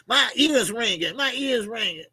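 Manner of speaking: lightly voiced mama, pitched high; imitating mama. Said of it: "Like my mama be saying".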